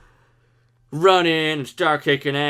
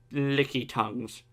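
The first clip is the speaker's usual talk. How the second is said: normal talking voice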